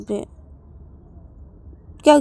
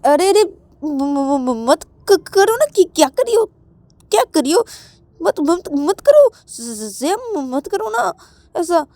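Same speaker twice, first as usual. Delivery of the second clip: high, loud stuttering please-stop; embarrassed, tripping over every word; flustered protest; 10 semitones higher, 5 dB louder, slower than usual. Acted. Kissed over and over, she stutters a loud, flustered plea. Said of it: "श्रुति श्रेया को बोलती कि".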